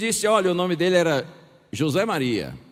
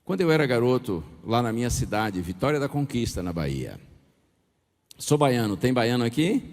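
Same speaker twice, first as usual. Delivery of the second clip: ordinary talk, nothing marked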